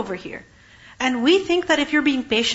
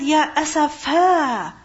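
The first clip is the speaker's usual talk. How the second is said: grieving lament; 3 semitones higher, 3 dB louder, much slower than usual. Acted. Voicing Yaqub's lament of grief.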